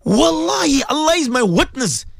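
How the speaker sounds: high, strained pleading near tears. Real, not acted. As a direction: plead with the listeners, close to crying. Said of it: "I'm saying this in tears".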